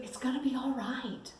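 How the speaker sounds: soft, high whispered comfort; reassuring whisper. Retold or imitated. Husband calming his frightened wife on the plane, whispered reassurance. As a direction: hush her gently. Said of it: "And he said".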